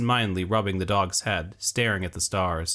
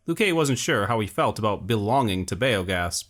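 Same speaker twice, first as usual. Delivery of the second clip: ordinary delivery, nothing marked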